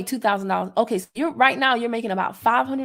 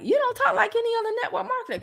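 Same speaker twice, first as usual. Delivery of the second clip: high mock admirer; 11 semitones higher, faster than usual. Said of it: "They like".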